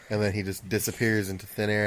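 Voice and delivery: normal talking voice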